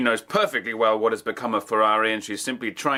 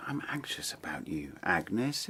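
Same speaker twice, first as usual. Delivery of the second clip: much softer, lower whisper; 3 semitones lower, 8 dB quieter than usual. Acted. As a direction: confide it tenderly, barely voiced. Tender confession to Agnes.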